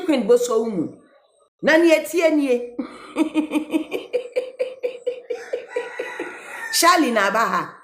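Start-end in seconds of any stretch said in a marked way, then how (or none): 3.1-6.7 high breathy burst of laughter. Real, not acted. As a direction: break into a wheezing laugh. Laughs at the comment.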